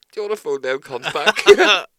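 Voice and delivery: loud, slow, drawn-out dare; loud slow mock proposal. Telling his mates to ring the woman back, loud and slow. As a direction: boom it out, slowly, egging your mates on. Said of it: "I was like".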